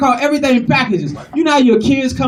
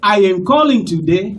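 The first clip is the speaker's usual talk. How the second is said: put-on formal, measured African accent; dignified African accent. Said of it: "they so dignified and eloquent".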